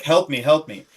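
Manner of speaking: put-on half-hearted echo of the stuck kid's plea; light retold child's plea; panicked kid. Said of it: "So he's like".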